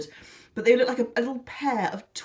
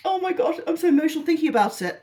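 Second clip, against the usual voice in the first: higher, hammed-up gush; mock emotional; 8 semitones higher, 4 dB louder, faster than usual. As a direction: pretend to be overcome, then drop it. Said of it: "No, I'm not really, I'm joking".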